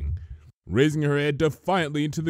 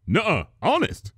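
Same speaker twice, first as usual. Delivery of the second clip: protesting; 8 semitones higher, 3 dB louder than usual. Acted. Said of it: "protested Spike".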